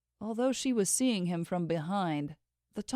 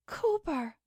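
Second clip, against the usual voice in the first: anxious call; 10 semitones higher than usual. Acted. Calling to fallen friend.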